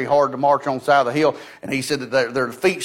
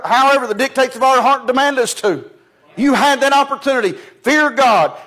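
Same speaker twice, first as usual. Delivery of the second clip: high, loud, fervent preaching; ringing revival-style sermon; full-force preaching; 7 semitones higher, 4 dB louder than usual. Acted. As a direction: rouse the congregation with each line. Preacher building to climax.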